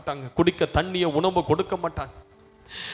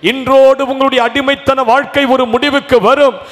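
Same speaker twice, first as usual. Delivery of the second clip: loud, higher, fervent proclamation; preaching at full force; 6 semitones higher, 11 dB louder than usual. Acted. Preacher promises the congregation deliverance, loud and fervent. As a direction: pour out the promise with rising fervour.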